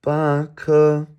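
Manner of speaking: "Ba ke" loud, high and level; high level tones. Shown as a demonstration. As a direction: hold both syllables of "Ba ke" on one high note. Teacher contrasts two tone patterns.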